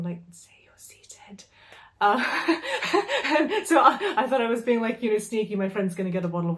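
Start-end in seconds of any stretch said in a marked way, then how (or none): 0.3-1.4 hushed unvoiced whisper, slow; retold whisper. Slipping a sneaky tip to a friend in line, whispered. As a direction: breathe it secretly, barely any voice. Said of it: "I whispered to my friend".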